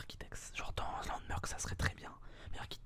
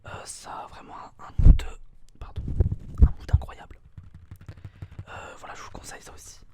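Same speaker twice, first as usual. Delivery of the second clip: right in your ear; 8 semitones higher, 10 dB louder than usual. Real, not acted. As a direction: close whisper in the ear.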